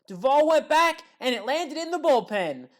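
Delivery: rising excited play-by-play; excited call. Real, not acted. Frazier drive to the wall.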